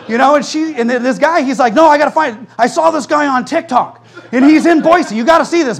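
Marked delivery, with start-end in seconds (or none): 1.7-5.8 high, loud, insistent retelling; imitated eager urging. Son urging his mom to see a TikTok, imitated eagerness. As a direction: press it excitedly, pitched up.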